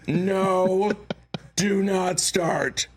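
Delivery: high, drawn-out plea not to laugh; mock warning. Actor to his friend on set, high mock warning on the verge of laughing. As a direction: warn a friend off in a high, held-back voice.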